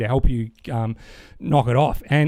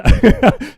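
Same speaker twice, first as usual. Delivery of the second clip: giggle; 10 semitones higher, 8 dB louder than usual. Real, not acted. Embarrassed about good looking bloke.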